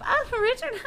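very high, hammed-up flirty greeting with giggle; flirty Cordelia.

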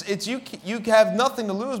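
usual speaking voice

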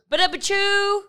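high rimshot vocal effect; drum rim-shot noise. Pretend. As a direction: make a ba-da-choo rimshot sound. Joke sound effect.

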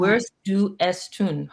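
slow, separated words; deliberate, measured pronunciation attempt; careful foreign reading. Real, not acted. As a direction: sound out the German word by word. Repeats unfamiliar German phrase carefully.

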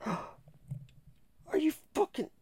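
gasp, then a very high, slow, disbelieving cry; real disbelief as the cat ruins the headphones; shocked gasp. Real, not acted. Cat chews through headphones.

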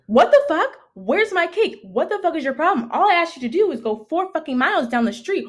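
mock outraged roommate, high and fast; blowing up. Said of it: "This bitch blew the fuck up".

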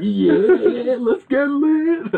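high, slow, strutting hype voice; cocky Roy Williams. Retold or imitated. Host mimics coach walking into the locker room, high and slow. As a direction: swagger in like you're hot stuff. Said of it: "thinking he's hot shit".

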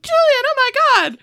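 high, loud cry of mock horror; mock alarm. Onlookers as the boy falls in the pool, mock alarm. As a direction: cry out in hammed-up panic.

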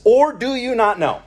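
high, loud rhetorical question; indignant challenge. Acted. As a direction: challenge them as an exasperated preacher. Voicing Paul's rebuke to his readers.

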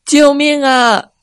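a slow desperate shout for rescue; calling for help. A narrator's reading. Ni stranded below the cliff calling for help, loudly. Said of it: "尼大声地叫道".

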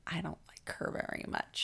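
soft conspiratorial whisper; imitated hushed gossip; confiding nurse. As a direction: confide it under the breath. Nurse confides dislike of doctor.

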